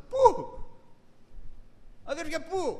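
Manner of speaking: sudden high, loud Πού; exclaimed Πού with surprise; excited where. Real, not acted. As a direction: fling the question out to the hall. Rhetorical question to congregation.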